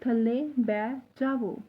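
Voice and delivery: slow, clear थल्ले बै जावो; teacher dictation pace; slow model sentence. Shown as a demonstration. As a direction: say it slowly twice for learners. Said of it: "तो पूरा sentence कैसे बोलेंगे".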